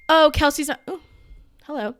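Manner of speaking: mock gossip voice. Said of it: "every week it's like".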